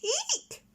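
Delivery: sudden piercing owl screech. Acted. Plop screeching down at the squirrel, a piercing eek. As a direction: let out a shrill eek to scare him.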